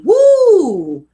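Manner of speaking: a high, loud whoop of recognition; excited whoop. Real, not acted. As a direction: let out a big woo. Reacting to relatable line.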